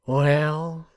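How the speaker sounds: loud, drawn-out contempt; scornful concession; contemptuous. A narrator's reading. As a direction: stretch it out disdainfully.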